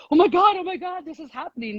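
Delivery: hammed-up giddy disbelief; mock amazement. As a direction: act out an overexcited freak-out, starting high. Acts out amazement she didn't feel.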